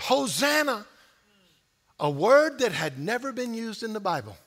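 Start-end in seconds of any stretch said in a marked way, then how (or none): none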